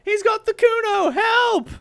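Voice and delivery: very high, loud shout; kid screaming for help. Acted. Kuno joins the screaming, high and loud. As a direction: scream like you're in danger. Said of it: "The boy joins in".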